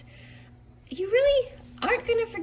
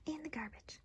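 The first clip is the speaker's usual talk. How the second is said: hushed, nearly whispered aside; muttered to self; 2 semitones lower, 16 dB quieter than usual. Acted. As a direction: say it under your breath while tidying. Character talks to self, tossing bag.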